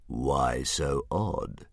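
low, slow amused dragon voice. Acted. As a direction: savor it like a bored monster. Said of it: "who appeared to be amused".

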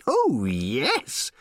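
high, squealing delight; squealing robot. A narrator's reading. Little robot squealing with joy to Ford. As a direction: squeal it like a giddy little machine. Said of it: "squealed the little robot".

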